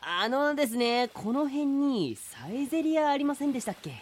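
an overly polite turtle asking a stranger the way; cheerful and pushy, a salesman turtle; eager polite turtle. Acted. Turtle addresses Saito.

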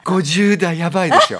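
laughing, loud and high about his fifties. Real, not acted. Professor tells novelist his fifties are great, laughing. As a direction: burst out laughing as you say it.

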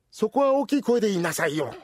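usual speaking voice

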